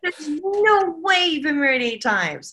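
high-pitched incredulous retelling; incredulous protest. Retold or imitated. Medium retells telling Larry off over marriages, incredulous. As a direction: push it high, then let it fall, disbelieving. Retelling own disbelief to the spirit.

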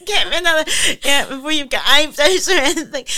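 loud, high, giggling through the words; laughing. Real, not acted. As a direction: keep laughing as the joke runs on. Laughing at own womb joke.

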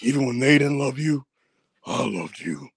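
a lightly voiced sinister older brother; Darth Vader voice. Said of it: "talking like Darth Vader".

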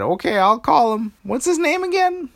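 put-on very high, loud old lady voice; forgetful granny asking again. Old lady finally agreeing to help, high and loud. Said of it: "she said".

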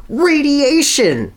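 very high, drawn-out mock scream; hammed-up slow screamed alarm; long high shriek of the word. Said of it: "screamed Sonic".

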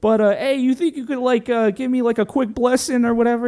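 high, hammed-up Italian-American Rocky; Rocky asking a favour in cartoon Italian-American; Rocky asking the priest for a quick blessing, mock Italian; mock Italian accent. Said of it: "my Italian".

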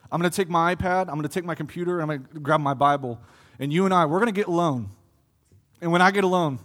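rising excitement, gonna get it done; confident planning. Retold or imitated. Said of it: "I said".

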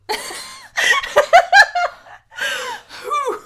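loud, high burst of laughter at her own pun; big laugh. Real, not acted. Cracking up at her own Deuce Gorgon pun, big high laugh. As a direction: let the laugh explode up high.

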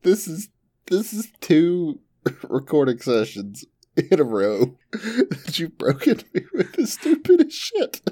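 can barely get the words out for laughing. Real, not acted. Broken up laughing at the joke.

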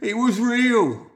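high, loud, drawn-out wail. A narrator's reading. Frightened witness insists to a doubter, wailing. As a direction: wail it desperately, stretching the words. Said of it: "wailed Crabtree".

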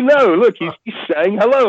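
retold excited insistence, high and loud; insisting. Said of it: "I'm like".

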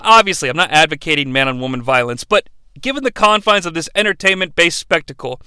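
usual speaking voice

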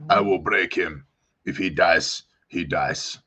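low menacing Russian boxer voice; deep, slow Drago threat; barbarian. Retold or imitated. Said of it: "Rocky four, homie".

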